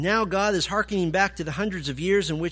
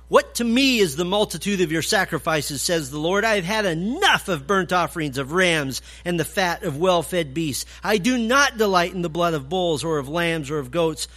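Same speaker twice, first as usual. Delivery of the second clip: louder, rising preaching of God's words; forceful scripture reading; 5 dB louder than usual. Acted. Preacher reading Isaiah's rebuke, loud and rising. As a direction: proclaim it as God's indignant voice.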